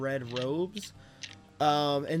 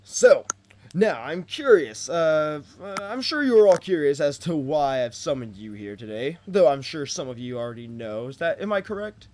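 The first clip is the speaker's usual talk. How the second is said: loud, beaming royal voice; friendly king voice; 6 dB louder than usual. Acted. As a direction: smile wide and address them as king. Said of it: "a big friendly smile and says".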